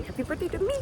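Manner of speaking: high, soft sing-song; sing-song self wish. Real, not acted. Wishing herself happy birthday, high and soft. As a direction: chirp it to yourself.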